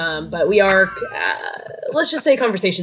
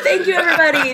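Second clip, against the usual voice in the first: mock celebrity thanks; 9 semitones higher than usual. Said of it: "cheering herself on in the background".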